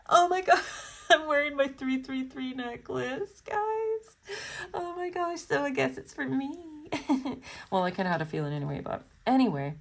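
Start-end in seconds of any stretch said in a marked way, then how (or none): 0.0-7.4 excited laughing. Real, not acted. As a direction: break into delighted giggles while talking. Shocked by matching 333 necklace.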